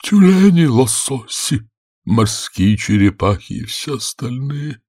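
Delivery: slow, high, drawn-out creature voice; plaintive turtle, growing animated; mournful storybook turtle. A narrator's reading. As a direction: intone it with long vowels. Narrator voices the Mock Turtle.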